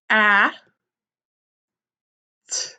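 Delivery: "hat" broken into a breathy h, a firm short "A", then a lone "T"; sounding out. Shown as a demonstration. Teacher helping pupils write "hat", sounding it out slowly. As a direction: sound out "hat" letter sound by letter sound, long pauses between. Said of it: "Let's sound that one out".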